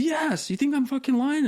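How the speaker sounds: high-pitched imitation of a bossy veteran barking orders; mock yell as Hovland.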